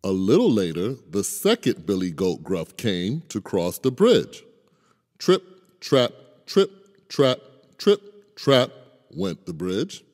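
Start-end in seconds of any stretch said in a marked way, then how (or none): 5.2-8.7 trip trap. A narrator's reading. Bridge sound as middle goat crosses.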